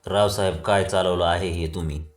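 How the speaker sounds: ordinary delivery, nothing marked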